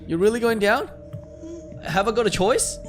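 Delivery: high, loud disbelieving question; incredulous protest. Acted. Player balking at going down to the basement, high loud protest. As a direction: push it up high and loud, aghast.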